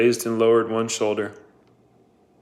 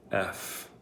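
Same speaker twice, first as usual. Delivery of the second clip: very soft whispered letter F; 4 semitones lower, 15 dB quieter than usual. A narrator's reading. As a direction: barely breathe the sound F. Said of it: "Zero whispered".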